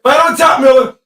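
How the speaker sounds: loud urgent yell; fired-up live shout; shouting at the screen. Real, not acted. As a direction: call it out like a coach from the bench. Live game calling out to player.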